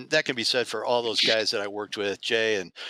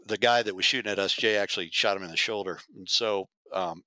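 ordinary talk, nothing marked

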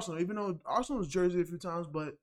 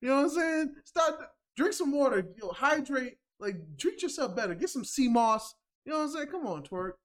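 mock scolding; 5 semitones higher than usual. Real, not acted. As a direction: scold him like an annoyed friend. Mock lecture to Twerk.

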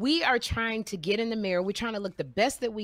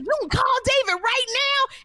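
high, amazed question; mock astonishment at the idea; surprised disbelief; 4 dB louder than usual. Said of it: "And they were like".

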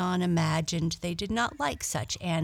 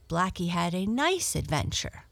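usual speaking voice

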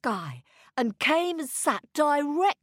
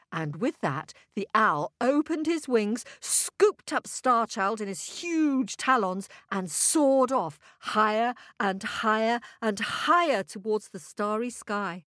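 usual speaking voice

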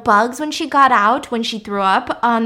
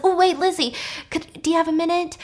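high, tentative request; hesitant calling back; 6 semitones higher than usual. Retold or imitated. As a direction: act out politely stopping someone. Anton calls her back, acted.

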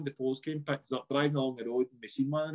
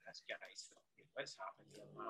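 hushed panic; 7 semitones lower, 21 dB quieter than usual. Retold or imitated. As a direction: breathe it under your voice as if the driver might hear. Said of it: "I'm like".

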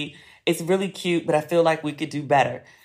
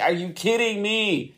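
exasperated disbelief; 4 semitones higher than usual. Retold or imitated. Retold disbelief, slow and higher.

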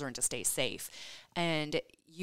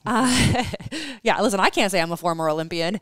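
loud laughing, higher and fast; laughing loudly; 6 semitones higher, 12 dB louder than usual. Real, not acted. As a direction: laugh through the words at your own blunder. Laughing at her own slip.

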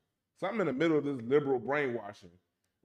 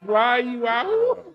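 incredulous; 8 semitones higher, 10 dB louder, much slower than usual. Real, not acted. Incredulous question.

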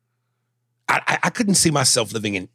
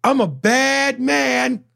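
high, slow, swaggering boast; drawn-out cocky brag; mock bravado; much slower than usual. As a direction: crow it like the top quarterback. Bragging he is Tom Brady.

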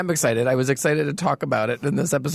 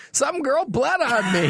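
mock announcement; 10 semitones higher, slower than usual. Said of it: "you said".

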